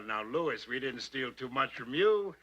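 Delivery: ordinary talk, nothing marked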